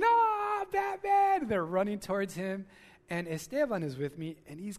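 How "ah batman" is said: high, loud thrilled shriek of a fan; excited screaming. Retold or imitated. Preacher imitating kids screaming at Batman, high loud shriek. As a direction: shriek it like a kid seeing a hero. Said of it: "children and adults are screaming".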